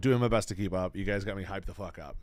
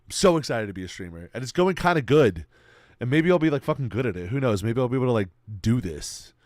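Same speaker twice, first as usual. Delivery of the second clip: excited; 2 semitones higher, 8 dB louder than usual. Real, not acted. Hyped streamer talking to chat.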